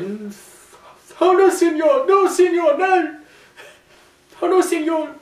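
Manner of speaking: high, loud panicked wrestler impression; mock pleading. Alberto begging as Big Show lifts him, mock panic. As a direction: plead in a hammed-up high yelp. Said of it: "Alberto's like".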